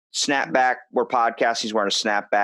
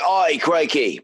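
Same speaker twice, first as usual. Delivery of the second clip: mock Australian accent; 7 semitones higher, 3 dB louder, slower than usual. Joking about how Americans picture Australians, in a hammed-up Aussie accent. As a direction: toss off a cartoon Australian accent. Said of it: "they go say".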